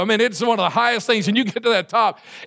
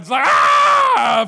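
long high hammed-up scream, much louder; mock scream; 5 dB louder, much slower than usual. Preacher acting out the roller coaster drop, long loud mock scream. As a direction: let out a drawn-out ride scream.

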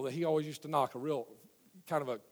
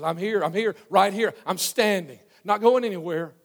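insistent, louder; 4 semitones higher, 9 dB louder, slower than usual. Acted. Same words repeated, rising urgency.

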